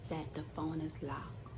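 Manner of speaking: ordinary delivery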